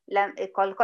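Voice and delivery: usual speaking voice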